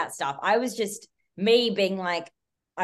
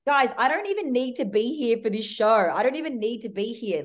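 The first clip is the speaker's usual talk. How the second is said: exasperated rant; 5 semitones higher than usual. Retold or imitated. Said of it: "I absolutely flipped it".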